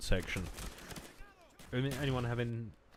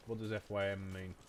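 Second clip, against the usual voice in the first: ordinary talk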